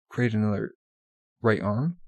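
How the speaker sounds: plain reading voice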